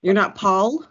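normal talking voice

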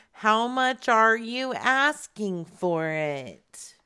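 drawn-out, careful asking; slow, deliberate question. Real, not acted. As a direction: stretch the words out for a slow listener. Keeps slow questioning of old man.

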